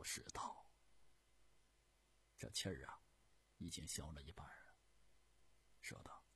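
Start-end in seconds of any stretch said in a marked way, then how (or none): none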